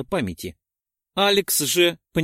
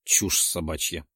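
dismissive; 6 semitones lower than usual. A narrator's reading. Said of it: "сам себе и ответил".

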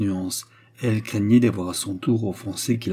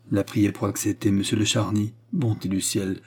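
ordinary delivery

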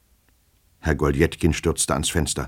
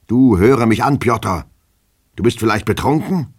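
raised, higher-pitched challenge; exasperated questioning; 6 semitones higher, 5 dB louder than usual. Acted. Master suspecting his servant is drunk, exasperated. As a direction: press him, ending on a high question.

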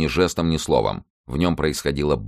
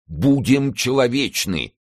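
much higher, slow, fervent plea; heartfelt plea; 10 semitones higher, 4 dB louder, slower than usual. A narrator's reading. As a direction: Athos begging his friends, slow and high. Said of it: "вскричал Атос с невыразимым чувством".

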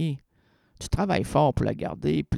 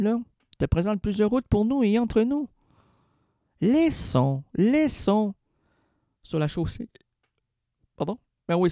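normal talking voice

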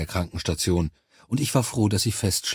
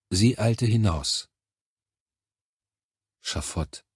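usual speaking voice

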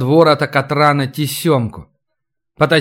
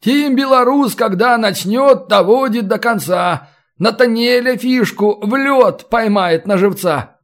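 high, animated boasting while at work; lively higher-pitched storybook boast; self-satisfied patter; 7 semitones higher than usual. A narrator's reading. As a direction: chant your own praises, pitched up. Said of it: "приговаривая".

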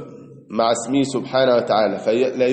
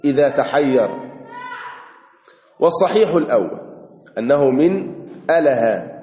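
usual speaking voice

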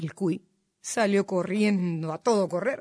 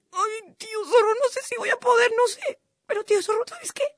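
very high, tearful doubt; sly mock helplessness; whimpering little cuy; 12 semitones higher, 3 dB louder than usual. A narrator's reading. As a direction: whimper as if about to give up. Cuy pretends he is about to fail.

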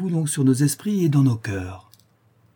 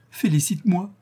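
much higher, bursting with joy; joyful cry; 8 semitones higher than usual. A narrator's reading. Éléonore crying out joyfully to her lover, much higher. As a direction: cry it out in delight. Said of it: "s'écria-t-elle".

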